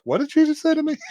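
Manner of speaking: high-pitched mock bewilderment; baffled dad. Dad taken aback by his daughter, mock bewildered. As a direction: play up a dad's disbelief. Acting the dad's baffled thought.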